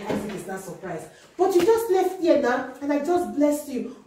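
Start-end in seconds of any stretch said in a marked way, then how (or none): 1.4-4.0 puzzled father. Acted. Isaac puzzled, voiced.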